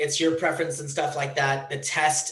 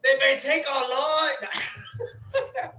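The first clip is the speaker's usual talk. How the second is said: high, drawn-out pretend war cry; mock battle yell; 9 semitones higher, 3 dB louder, much slower than usual. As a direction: yell it badly on request, slow and pitched up. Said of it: "Can you yell freedom?".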